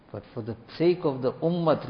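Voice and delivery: usual speaking voice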